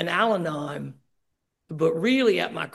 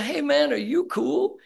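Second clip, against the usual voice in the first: mock friendly check-in; 9 semitones higher, 4 dB louder, slower than usual. Checking on an angry newcomer.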